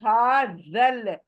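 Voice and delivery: slow हाज़ल, mouth wide open; open-mouthed recitation. Shown as a demonstration. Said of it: "मुंह खोलो".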